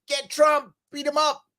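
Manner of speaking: mock cheer of a rival gleeful at the raid; mock gleeful Cuomo. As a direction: egg on the attack like an eager fan. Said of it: "Shouldn't he be thinking that?".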